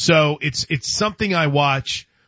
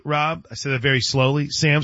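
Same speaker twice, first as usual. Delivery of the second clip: normal talking voice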